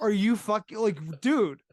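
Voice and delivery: loud, high, sputtering incredulity; appalled and lost for words; exasperated disbelief. Acted. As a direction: sputter loudly at a friend's blunder. Acted outrage at the misspelling.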